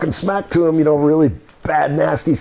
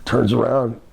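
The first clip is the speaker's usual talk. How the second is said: ordinary talk, nothing marked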